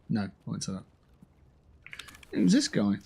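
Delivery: normal talking voice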